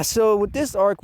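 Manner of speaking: ordinary delivery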